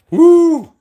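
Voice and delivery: short, high, loud woo; celebratory whoop. Real, not acted. Fan cheering the win, loud high whoop. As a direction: let out a sharp whoop.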